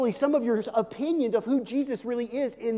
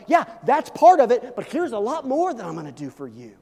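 eager reply; 3 semitones higher, 7 dB louder than usual. Acted. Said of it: "And Jesus is like".

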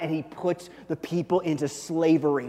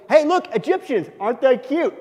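high, loud playful taunt; hammed-up high-pitched mockery; mock cooing; 8 semitones higher, 8 dB louder than usual. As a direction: point them out like tiny cute creatures. God imagined mocking the Egyptians.

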